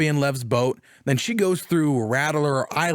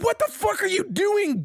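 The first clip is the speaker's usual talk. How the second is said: shocked disbelief. Retold or imitated. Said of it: "And I'm like".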